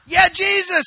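very high, loud whoop of support; imitating an elated supporter; excited cheering. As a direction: whoop it like a fan whose side just scored. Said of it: "the scribes are all excited".

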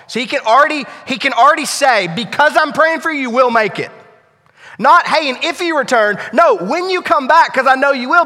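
loud, high, passionate sermon voice; preaching at full force. Acted. Preacher voices Jesus' promise to Peter, loud and high. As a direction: proclaim the promise with fire.

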